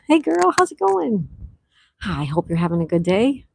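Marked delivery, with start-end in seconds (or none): 0.1-1.3 bubbly greeting. Real, not acted. Greeting a player in chat.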